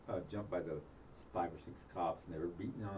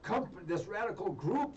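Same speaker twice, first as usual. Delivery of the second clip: mocking news voice; 8 semitones higher than usual. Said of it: "put him in the news as".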